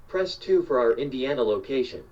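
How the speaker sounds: normal talking voice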